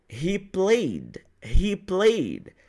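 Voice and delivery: "played" as one syllable with a light "d"; correct "ed" pronunciation. Shown as a demonstration. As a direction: keep "played" short, "pleyd".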